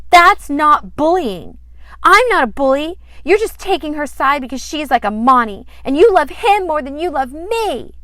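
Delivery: a daughter yelling at her father about her brother; upset outburst. Acted. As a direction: shout it out like a hurt, furious girl. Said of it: "Sandy got very upset".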